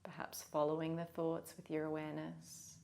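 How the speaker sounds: soft, unhurried meditation voice; guided meditation. Real, not acted. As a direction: guide them gently, leaving long pauses.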